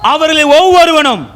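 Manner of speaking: high, ringing verse reading; preacher calling out the verse; declaimed scripture reading. Acted. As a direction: proclaim each question, pausing after it. Scripture read aloud.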